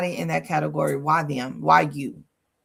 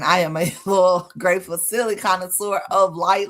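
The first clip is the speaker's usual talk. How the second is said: normal talking voice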